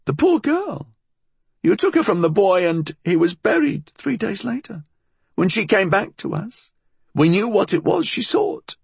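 put-on high-pitched old-woman voice; high, bitter old crone; accusing old woman. A narrator's reading. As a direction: recount it reproachfully to the man.

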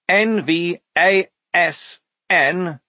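high, slow separate letters; spelling out. Acted. Lieutenant decoding the message, slowly spelling out. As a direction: call out each letter as it comes.

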